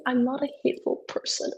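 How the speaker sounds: normal talking voice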